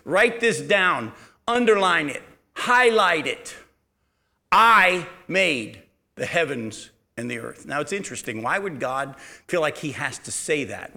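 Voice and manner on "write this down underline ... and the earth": slow, emphatic dictation; commanding, deliberate pronouncement; God instructing Moses. Acted. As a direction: give each command firmly and separately, slowing right down. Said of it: "the first thing he says to Moses is this".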